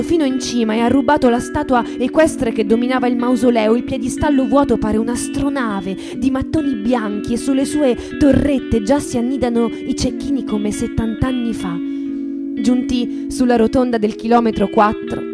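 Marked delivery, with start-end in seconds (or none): none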